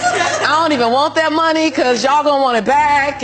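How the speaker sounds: loud, laughing, incredulous; laughing disbelief. Retold or imitated. Insisting to the teller the balance is wrong, laughing loudly.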